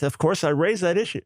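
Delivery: ordinary talk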